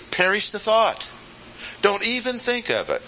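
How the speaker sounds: high-pitched, emphatic dismissal; appalled revulsion. Acted. Preacher paraphrasing "May it never be", appalled. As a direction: shut the idea down, pitched well up. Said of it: "an answer of revulsion".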